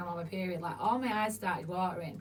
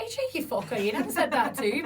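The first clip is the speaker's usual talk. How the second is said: higher, louder playful indignation; retold mock outrage; 4 semitones higher, 6 dB louder than usual. To an old workmate after a jibe, mock-offended. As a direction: act lightly affronted, raising pitch and volume.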